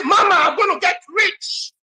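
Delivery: very high-pitched, elated cry; overjoyed announcement. Retold or imitated. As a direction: cry it out to mama, thrilled. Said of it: "I was so happy".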